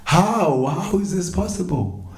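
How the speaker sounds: high, alarmed outcry of disbelief; freaking out. Acted. Mother hears the impossible cure, freaking out. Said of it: "Mother Yashoda was freaking out".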